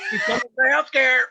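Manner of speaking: high, hammed-up shout; mock alarm shout. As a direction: sound the alarm like a panicked guard. Acting border guard raising alarm.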